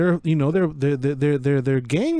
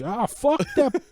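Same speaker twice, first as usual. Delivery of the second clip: higher-pitched mock tough dismissal; George Lopez imitation; 9 semitones higher than usual. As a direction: toss it off like a sitcom gangster. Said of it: "I think George goes like".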